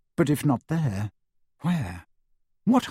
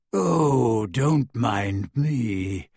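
slow, low, faint moaning stone head; 4 semitones lower, much slower than usual. A narrator's reading. A broken gargoyle head moans to Harry as he leaps over, slow and faint. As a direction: moan it out, feebly resigned. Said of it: "it moaned faintly".

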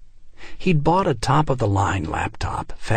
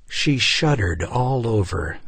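usual speaking voice

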